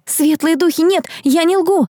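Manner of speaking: high-pitched, pleading denial; distressed protest. A narrator's reading. Girl accused of lying protests, high and distressed. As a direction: protest desperately, voice shooting up.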